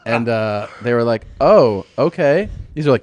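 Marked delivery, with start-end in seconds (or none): 1.4-2.5 slow unimpressed oh okay. Retold or imitated. Kids react slowly.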